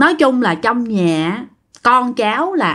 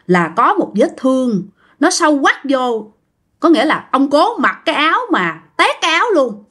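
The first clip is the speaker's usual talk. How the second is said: plain reading voice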